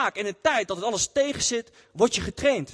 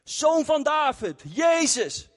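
high, slow call, a bit louder; louder retold shout; 7 semitones higher, much slower than usual. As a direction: call out over the people trying to hush him. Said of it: "hij begon nog harder te schreeuwen". (nog harder roepen, hoog en langzaam)

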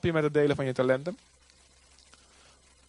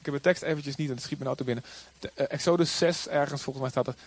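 usual speaking voice

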